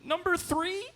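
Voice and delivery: tiny, very high, uncertain question; panicked little girl. Retold or imitated. Terrified five-year-old answering on the Jumbotron, high timid squeak. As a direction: imitate a scared child guessing. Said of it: "She panicked".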